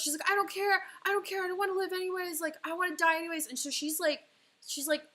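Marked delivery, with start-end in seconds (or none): 0.3-3.5 fast, much higher and louder despair; mock hysterical mother yelling in the car; retold mom screaming. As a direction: yell it out, past caring. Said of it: "screaming at me".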